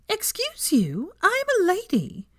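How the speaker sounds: high, slow prissy shriek; mock offended. Drunk Hermione to Malfoy, high mock outrage.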